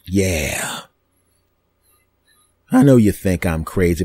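normal talking voice

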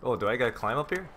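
ordinary talk, nothing marked

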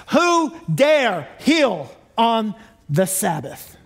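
slow, scandalized Pharisee voice, dragged out; mock the Pharisees' outrage slowly; indignant Pharisee. Preacher mocking the Pharisees' Sabbath outrage, slow and scandalized.

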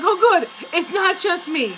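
high, loud burst of relief; relieved exclamation. Retold or imitated. Relieved to hear other women say it too, relieved exclamation.

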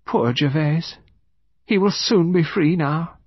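high, airy woman's voice; serene widow. Acted. Widow speaking of her dead husband, serenely. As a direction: float it gently up high.

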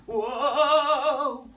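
high loud whoa; alarmed whoa. Acted. Reader voicing Lulu nearly falling, high alarmed whoa. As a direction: cry out as she slips.

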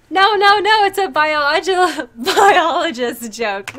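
talking through laughter; real laughter breaking in; laughing. Real, not acted. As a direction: giggle through the words.

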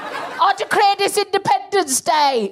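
mock Cockney accent, high and drawn out; Nigel Farage. Comic imitating a politician's victory speech, mock Cockney. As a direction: proclaim it slowly in a high, put-on Cockney. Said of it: "He's in a fucking Cockney".

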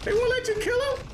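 very high, squeaky protest; indignant disbelief. Real, not acted. Gamer protesting the game's rules, indignant disbelief. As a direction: squeak it out, incredulous.

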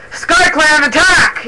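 high, loud, drawn-out charge order; leader's battle yell; yelled battle cry. A narrator's reading. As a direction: yell it to launch the attack. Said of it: "yelled Mothpelt".

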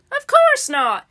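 high-pitched, emphatic storybook denial. A narrator's reading. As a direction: brush off a silly question, pitch leaping up. Dismisses mistaken idea in story.

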